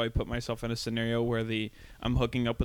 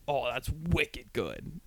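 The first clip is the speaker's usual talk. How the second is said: slow, soft New England drawl on wicked good; breathy-slow Boston accent in the bedroom; 5 dB quieter, much slower than usual. Retold or imitated. Comedian imitating a Boston hookup, slow Boston accent. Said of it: "imagine you're with somebody and they're like".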